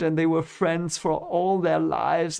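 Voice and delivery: ordinary talk, nothing marked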